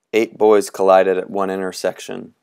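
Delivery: plain reading voice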